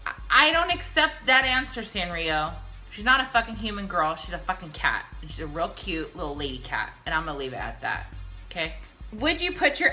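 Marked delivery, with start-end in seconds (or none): none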